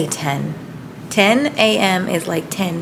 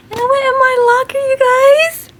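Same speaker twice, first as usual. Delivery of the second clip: high, loud and fast with delight; excited squeal; 6 dB louder than usual. Real, not acted. Telling viewers about her locker, thrilled squeal.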